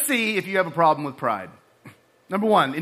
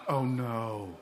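much lower, much softer groan; dread; 8 semitones lower, 11 dB quieter than usual. Retold or imitated. Cadet facing the pie, quiet dread. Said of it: "And I'm like".

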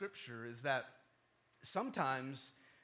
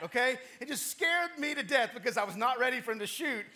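ordinary talk, nothing marked

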